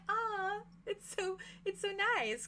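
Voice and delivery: sweet coo. Acted. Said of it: "she's like".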